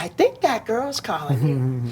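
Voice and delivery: lightly voiced mother, slow and soft; mother's voice. Mother pointing out the girl to her son, slow soft nudge. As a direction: softly imitate a mother's nudge.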